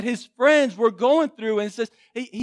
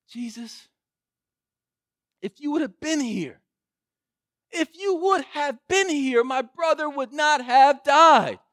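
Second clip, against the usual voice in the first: grieving plea; 7 semitones higher, 4 dB louder than usual. Retold or imitated. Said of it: "And she says".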